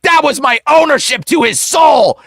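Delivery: high, hammed-up possessive outrage; mock demon shouting its claim; angry demon. Demon claiming ownership of a soul, indignant.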